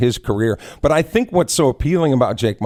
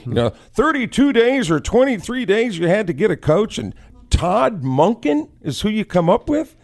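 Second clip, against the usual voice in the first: higher, indignant jab at the hire; mocking the complainers, pitched up; mock outraged fan; 8 semitones higher than usual. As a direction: play the scoffing Browns fan. Voicing upset Cleveland fans.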